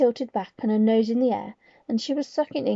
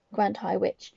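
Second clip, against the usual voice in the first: ordinary delivery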